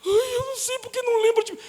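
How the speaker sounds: high, whiny childlike mock voice; childish whiny believer. Preacher mocking a childish believer, high and whiny. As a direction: mimic a babyish churchgoer whining. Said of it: "Tem crente que é tão criança".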